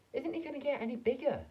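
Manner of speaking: very high, loud anxious question; father asking in high alarm; worried father. A narrator's reading. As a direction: fret about it aloud.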